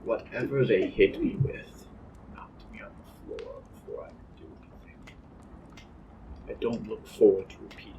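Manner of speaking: low, fast whisper. Acted. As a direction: keep it secret from guards. Prisoners plotting quietly in cell.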